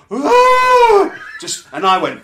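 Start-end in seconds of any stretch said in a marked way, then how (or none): 0.1-1.1 high, loud wailing cry; mock anguished wail. Imitating the young [name] fan falling to his knees wailing. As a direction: throw yourself into a howl of grief. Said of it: "drops to his knees and goes".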